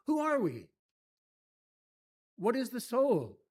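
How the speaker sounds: normal talking voice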